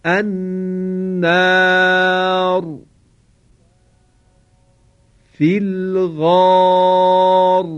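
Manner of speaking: tajweed recitation demo of a heavy ر after a long آ; heavy R recited. Shown as a demonstration. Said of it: "توجه داشتید که را تفخیم شد، یعنی درشت تلفظ شد".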